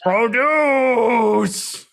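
high, drawn-out mock scream; long, high mock yell at talking volume; imitated scream. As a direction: stretch it out like a screaming villain. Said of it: "he just screams".

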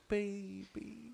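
put-on high, soft, drawn-out "Baby"; sweet pleading call; whiny girlfriend voice. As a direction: coax him like a cuddly partner. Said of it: "looks at me and goes".

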